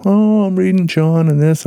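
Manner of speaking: high put-on coworker voice; coworker's reply. Coworker quoted in work story.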